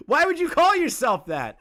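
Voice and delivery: real laughing; laughing in disbelief. Real, not acted. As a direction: laugh it out, incredulous. Mocking disbelief at the name.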